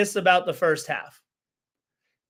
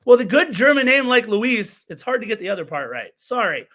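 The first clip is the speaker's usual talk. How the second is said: usual speaking voice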